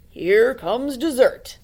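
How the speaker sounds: slow, loud, put-on wolf voice; greedy wolf. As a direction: drawl it out, licking lips. The wolf speaks.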